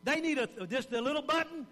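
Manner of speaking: normal talking voice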